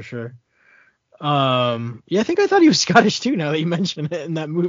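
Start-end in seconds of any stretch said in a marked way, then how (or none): 2.1-4.7 laughing. Real, not acted. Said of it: "That's funny".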